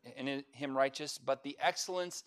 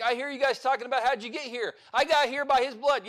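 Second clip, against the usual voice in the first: eager; 8 semitones higher, 5 dB louder than usual. Retold or imitated. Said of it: "the other guy's gonna be like".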